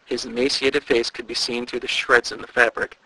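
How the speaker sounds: usual speaking voice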